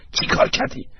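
urgent worried whisper; anxious. A narrator's reading. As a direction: whisper anxiously, pressing for an answer. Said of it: "با اضطراب از ایگنشس پرسید". (نجوای نگران و مضطرب)

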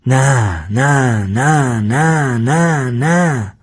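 repeated parroting, evenly sing-song; echoing mock cry, no real force; mimicking a shout. A narrator's reading. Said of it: "گوگلی هفت بار ادایش را درآورد".

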